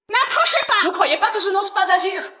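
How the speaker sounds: high-pitched panicked yell; frantic warning. Acted. Hostage taker to those approaching, frantic warning. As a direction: scream them back.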